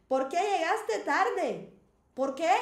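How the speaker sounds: loud, high, indignant demand; emotional questioning. Shown as a demonstration. Teacher acts out asking why someone came late, emotional questioning. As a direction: demand an explanation, loudly stressing QUÉ. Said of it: "expressar uma emoção".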